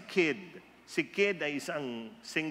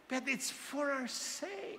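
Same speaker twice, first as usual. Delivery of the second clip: soft high stress; 10 semitones higher, 7 dB quieter, slower than usual. Acted. Preacher soft high emphasis.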